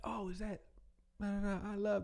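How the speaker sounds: high-pitched mock mama butting in; nosy mama. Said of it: "your mama can get on the phone".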